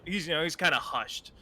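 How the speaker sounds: usual speaking voice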